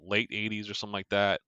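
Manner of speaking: normal talking voice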